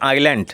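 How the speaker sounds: island said as "ailand", silent s; আইল্যান্ড with no s sound; teacher models island as ailand; pronunciation of island. Shown as a demonstration. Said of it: "উচ্চারণ লক্ষ্য করো, এস silent".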